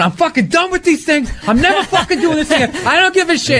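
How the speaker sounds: high, strained rant of a fed-up comic; hammed-up tantrum on the phone; flipping out. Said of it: "flipping out".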